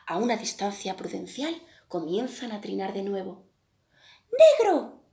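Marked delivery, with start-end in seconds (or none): 4.3-5.0 much higher and louder shriek of a little bird; the finch spots the crow and cries out loud; finch exclaiming. A narrator's reading. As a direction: cry it out like a startled finch. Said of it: "exclama el pinzón".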